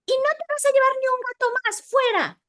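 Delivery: high, fast mock scolding as the cat; cat's scolding voice. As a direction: squeal it out like an angry cat. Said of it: "como diciendo". (voz de gata regañona, aguda y rápida)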